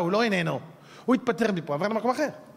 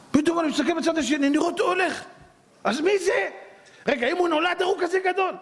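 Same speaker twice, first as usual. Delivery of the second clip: higher, astonished telling; amazed; 12 semitones higher than usual. Real, not acted. Rabbi tells the congregation, astonished, pitched up. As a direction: tell it wide-eyed, pitched up in wonder.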